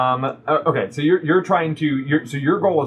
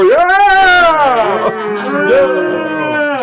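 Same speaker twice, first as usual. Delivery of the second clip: long, high roaring cheer; crowd cheer; 13 semitones higher, 9 dB louder than usual. Acted. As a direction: holler like a whole rowdy tavern. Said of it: "a bunch of people go".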